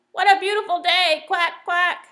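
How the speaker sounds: loud, high squawking duck voice; shouting duck. Acted. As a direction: belt it out like a noisy duck. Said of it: "shouts Dottie".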